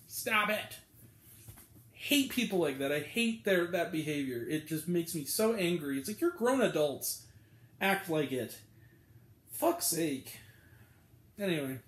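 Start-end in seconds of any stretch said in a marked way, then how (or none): none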